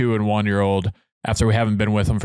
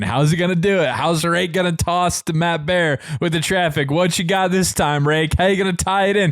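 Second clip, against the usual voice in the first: higher-pitched, rolling run of questions; eager anticipation; 6 semitones higher than usual. Retold or imitated. Listener awaiting the host's next traffic toss, excited anticipation. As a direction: build suspense like a keen fan.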